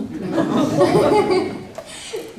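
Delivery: big high laugh after recalling her scream; loud burst of laughter. Real, not acted. Telling friends about her first yes, a loud high laugh. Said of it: "j'ai hurlé dans les chiottes".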